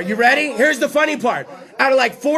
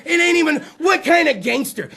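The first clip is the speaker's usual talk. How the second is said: usual speaking voice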